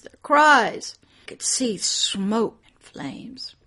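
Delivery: ordinary delivery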